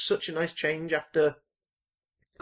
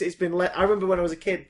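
usual speaking voice

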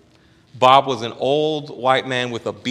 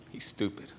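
whisper; 11 dB quieter than usual. Retold or imitated. Bob's imagined thoughts.

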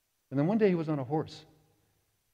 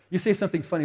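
usual speaking voice

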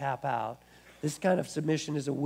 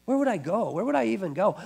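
higher-pitched insistent question; emphatic retold plea; 5 semitones higher, 4 dB louder than usual. Peter appealing to Jesus, emphatic retold question. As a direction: raise the pitch as you imitate Peter's appeal. Peter's answer repeated with feeling.

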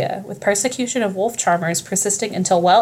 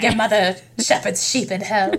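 put-on laughing raspy feral boy. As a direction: rasp it out with a laugh. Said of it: "this weird raspy voice".

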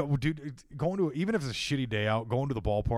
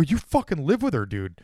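exasperated retort; 10 semitones higher, 6 dB louder than usual. Retold or imitated. Retold spat with his dad.

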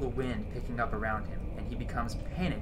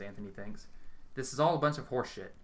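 ordinary delivery, nothing marked

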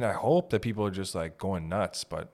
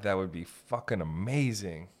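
usual speaking voice

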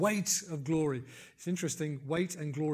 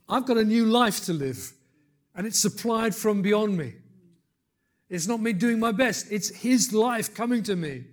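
higher, louder declaration; emphatic preaching; 5 semitones higher, 6 dB louder than usual. Real, not acted. Preacher declares his new life to the congregation, emphatic preaching. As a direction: proclaim it with conviction.

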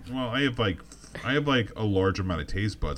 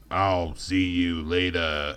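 low, slow bounty hunter growl; Boba Fett voice; 4 semitones lower, much slower than usual. Retold or imitated. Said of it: "How was my Boba Fett?".

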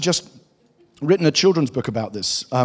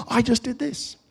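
put-on high, soft boast of a proud little boy; proud child, pitched up; pleased with himself; 9 semitones higher, 4 dB quieter than usual. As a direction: imitate a child quietly chuffed with himself. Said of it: "he's really pleased with himself".

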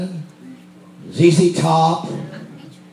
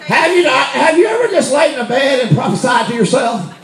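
preaching at full force; 8 semitones higher than usual. Acted. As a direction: preach it out to the congregation, full throttle.

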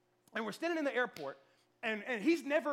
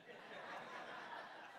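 breathless silent laugh; 15 dB quieter than usual. Real, not acted. Laughing at his own story.

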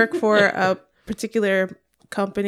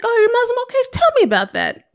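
high-pitched, keen curiosity; eager interest; 12 semitones higher, 3 dB louder than usual. Acted. Models open-minded reply to believers.

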